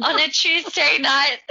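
high, laughing protest; mock indignant. Real, not acted. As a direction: act scandalised with a laugh. Mock protest about drinking.

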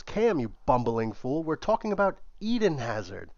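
exasperated tirade climbing in pitch; furious rant. Acted. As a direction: yell at the clueless owner. Michael exploding at Cam.

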